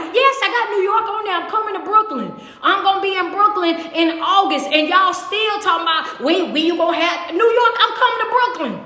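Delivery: high-pitched, worked-up insistence; exasperated reply. Retold or imitated. Host answering New York fans filling the inbox, exasperated. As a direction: protest in a high voice as if pestered.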